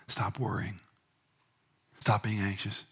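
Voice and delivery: softly telling his soul to stop worrying; quiet calming self-talk; gentle self-talk. Acted. Preacher calming his own soul, softly.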